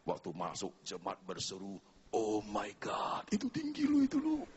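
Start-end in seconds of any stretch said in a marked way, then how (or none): 2.1-4.4 awed gasp. Retold or imitated. Congregation gasping as the daughter enters the church, then squealing.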